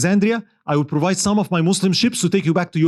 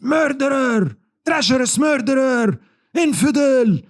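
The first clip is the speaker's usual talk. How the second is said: high, acted angry yell of insults; a grieving woman's high accusing cry; 10 semitones higher, slower than usual. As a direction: hurl the names at him. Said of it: "yelling at him, calling him names".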